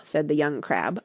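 usual speaking voice

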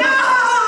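high, loud mock scream; imitated kids' wail. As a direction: wail high like two terrified children. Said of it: "They're going".

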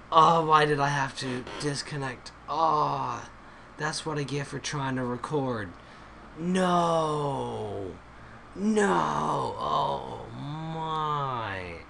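drawn-out, moaning disappointment; mock-anguished lament. Real, not acted. Gamer lamenting a lost server connection, mock anguish. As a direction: wail the "no"s in overplayed despair. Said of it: "I'm crying on the inside".

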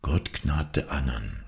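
slow, hushed remembered words; soft inner echo. A narrator's reading. Dying woman's words echo in Hauke, soft and slow. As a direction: say it softly and slowly, as if to yourself. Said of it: "sprach es leise in ihm".

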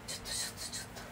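hushed whispered "ちょっと、ちょっと" with no voice. Real, not acted. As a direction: whisper ちょっと so as not to wake anyone. Quiet so papa isn't woken. (ささやき声の「ちょっと」)

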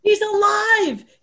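high, slow, breathless wonder at good news; joyful awe. Acted. Mary tells the other woman of the risen Lord, awed joy. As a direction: draw it out in amazed joy. Women realise Jesus has risen.

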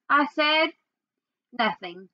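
usual speaking voice

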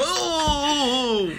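mock drunk laughter. Mocking how drunks laugh at bad jokes, high and giggly. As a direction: ham up a tipsy giggle at a bad joke. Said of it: "you, you drunk".

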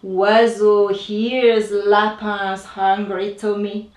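ordinary delivery

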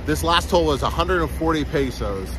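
ordinary talk, nothing marked

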